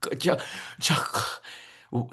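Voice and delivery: broken "g-, g-, jag-, jag-" starts, fading to a breath; choked stammer. Shown as a demonstration. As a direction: stammer on the name as if the throat locks up. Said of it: "stuttering and stammering on the throat".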